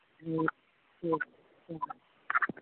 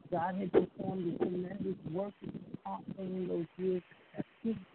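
distant, muffled phone voice; far away; 9 dB quieter than usual. Real, not acted. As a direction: sound far off with wind noise. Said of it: "it sounds like it's very, very far away".

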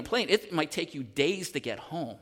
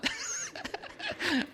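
laugh; 5 dB quieter than usual. Real, not acted. Laughs after his quote.

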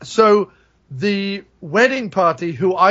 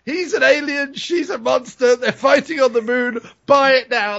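raised, hammed-up salesman spiel; mock excited sales pitch, higher voice; 7 semitones higher than usual. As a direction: pitch the movie like a pushy shop seller, half joking. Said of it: "And I'm like".

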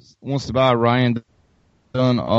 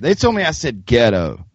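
ordinary delivery

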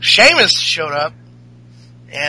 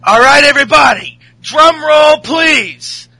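loud, high-pitched crowd address; big showman's announcement, raised and loud; hyping up crowd; 8 semitones higher, 8 dB louder than usual. Retold or imitated. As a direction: call out to the whole arena. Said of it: "and he's like".

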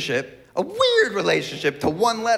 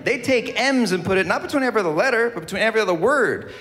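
ordinary delivery

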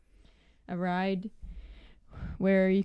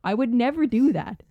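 "never" pushed higher and louder; emphatic denial; 4 semitones higher, 6 dB louder than usual. Retold or imitated. Employee defending herself to an angry guest, emphatic denial. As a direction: protest with a hard stress on "never".

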